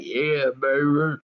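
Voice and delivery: put-on slow, lecherous yeah baby; mock pervy drawl. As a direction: ham up a pervert's drawl. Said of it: "and be like".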